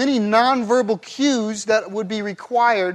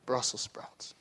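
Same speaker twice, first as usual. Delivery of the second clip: grimacing, breathy hush; whispered low disgust; 7 semitones lower, 12 dB quieter, slower than usual. Shown as a demonstration. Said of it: "you could have a grimace like me".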